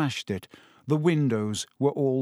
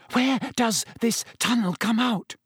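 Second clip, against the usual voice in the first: high, breathless question; 10 semitones higher than usual. A narrator's reading. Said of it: "Hermione asked breathlessly from behind him".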